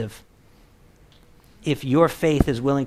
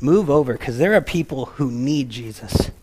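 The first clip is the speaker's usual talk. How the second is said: ordinary talk, nothing marked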